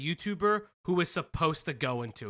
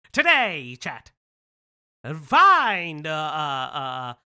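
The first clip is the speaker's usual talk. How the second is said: put-on loud, higher old-time Mid-Atlantic accent announcer; 6 semitones higher, 8 dB louder, slower than usual. Shown as a demonstration. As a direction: boom it out like a 1940s newsreel with a Mid-Atlantic accent. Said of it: "Atlantic accent is like".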